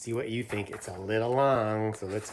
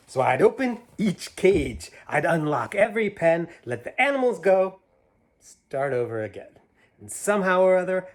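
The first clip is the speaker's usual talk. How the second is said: higher, louder, bouncy rhyme reading; reading to children; 5 semitones higher, 6 dB louder than usual. Acted. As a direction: read it big and playful for a child. Reads picture book aloud.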